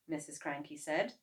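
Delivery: ordinary delivery, nothing marked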